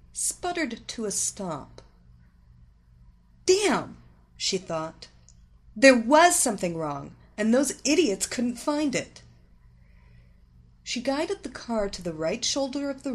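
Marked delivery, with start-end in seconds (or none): 3.5-4.0 high, loud exasperated outburst; frustrated yelp, falling fast; annoyed curse. A narrator's reading. Stranded driver curses in thought. 5.8-9.2 loud, irritated inner complaint; raised, exasperated voice; annoyed. A narrator's reading. Stranded driver fumes in thought.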